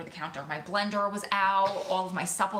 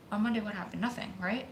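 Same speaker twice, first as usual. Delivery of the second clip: ordinary talk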